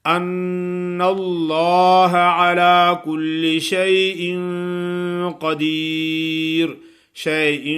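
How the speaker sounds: slow, loud, high chant with a held ghunna; tajweed recitation. Acted. Teacher recites the verse for students, loud tajweed chant. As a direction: recite slowly with the nun held.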